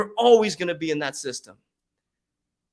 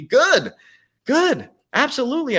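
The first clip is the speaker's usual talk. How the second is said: much higher and louder; excited agreement; 11 semitones higher, 5 dB louder than usual. Real, not acted. Coach welcoming critics, high and loud. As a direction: cheer it gladly.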